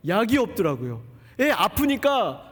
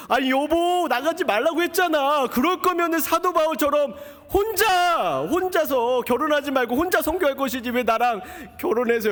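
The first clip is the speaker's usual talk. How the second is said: wife yelling; 8 semitones higher than usual. Retold or imitated. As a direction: let it burst out as a shocked, shouting wife. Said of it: "제 아내가 그렇게 소리 지르는 건 제가 처음 봤어요".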